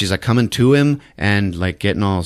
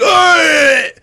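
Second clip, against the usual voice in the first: loud, much higher yell of pain; pained yelp; 7 dB louder than usual. Retold or imitated. Kevin bitten by the snake lady, loud yelp. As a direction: yelp like you've just been bitten. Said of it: "and he's like".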